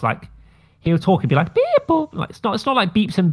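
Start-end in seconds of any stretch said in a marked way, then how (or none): 1.5-2.1 very high-pitched electronic "beep, boop"; computerized beeping. Retold or imitated. Podcaster mimicking Puppy Cat's talk for co-hosts, high computerized beeping. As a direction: imitate a cartoon robot's high beeps. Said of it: "in this computerized voice".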